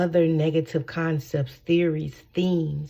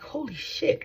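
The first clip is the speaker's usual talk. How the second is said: retold amazement; 9 semitones higher, 4 dB quieter, slower than usual.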